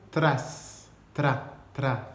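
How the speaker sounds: "tra" with a trilled R; spring from the T into a rolled R in "tra"; rolled R after T. Shown as a demonstration. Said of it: "en roulant le R". (« tra » en roulant le R)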